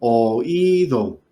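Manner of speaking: o-ÍÍÍ-do, breaking the vowels apart; stress on the Í. Shown as a demonstration. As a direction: hold the í in "Oído". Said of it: "cayó en la I".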